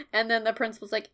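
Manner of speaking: usual speaking voice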